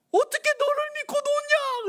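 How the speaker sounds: very high, loud mother voice; mother's distrust. Retold or imitated. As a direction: doubt your son shrilly. (아들을 못 믿는 어머니 목소리)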